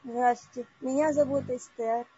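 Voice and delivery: ordinary delivery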